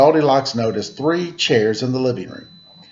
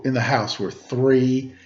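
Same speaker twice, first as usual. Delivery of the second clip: ordinary delivery, nothing marked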